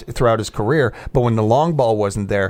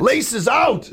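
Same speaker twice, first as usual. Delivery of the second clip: high, hammed-up movie catchphrase; Ace Ventura quote; 12 semitones higher than usual. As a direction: call it out like a comic film line. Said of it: "star of Ace Ventura".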